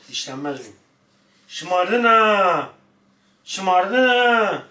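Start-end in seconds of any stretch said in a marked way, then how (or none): 1.5-4.6 high, loud teasing scold; playful scolding. Real, not acted. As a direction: tease the dog for being spoiled, loud. Scolding the spoiled dog.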